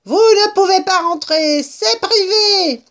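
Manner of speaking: very high, loud rat voice; curt rat. A narrator's reading. Rat barring intruders from private ground, high curt squeal. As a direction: squeal it like an indignant little rat. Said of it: "fit-il sèchement".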